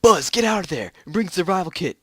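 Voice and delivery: urgent order. Acted. Astronaut urgently calls partner.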